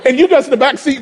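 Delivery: usual speaking voice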